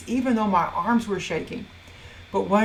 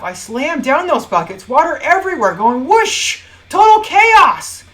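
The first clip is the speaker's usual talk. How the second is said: triumphant yell, climbing high; explosive outburst; 7 semitones higher, 11 dB louder than usual. Acted. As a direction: let it all crash out loudly. Acting out slamming the buckets down.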